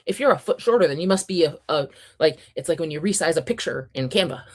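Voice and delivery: usual speaking voice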